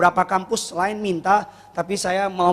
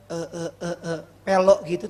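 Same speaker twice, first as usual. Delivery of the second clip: imitating stammer; 4 semitones lower than usual. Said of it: "tapi ngomongnya kayak".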